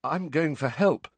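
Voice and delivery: a touch of urgent fright; scared voice. A narrator's reading. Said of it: "said the same scared voice".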